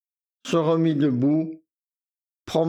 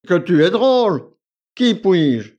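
louder, higher exasperated outburst; exasperated man; 4 semitones higher, 7 dB louder than usual. A narrator's reading. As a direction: throw up your hands at her. Victor s'agace contre Akoulina. (homme exaspéré, plus fort et plus aigu)

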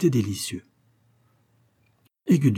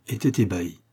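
usual speaking voice

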